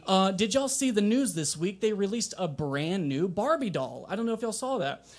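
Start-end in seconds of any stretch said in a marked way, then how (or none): none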